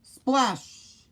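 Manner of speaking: high, falling Splash!; Splash! called high and falling; splash sound. A narrator's reading. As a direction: call out the splash like a sound effect. Sound effect word in story.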